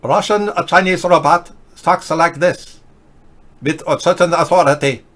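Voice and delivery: a lightly put-on robot voice with authority; Russian Chinese robot. Host obliging a viewer's request, mock robot voice. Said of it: "do the Russian Chinese robot again".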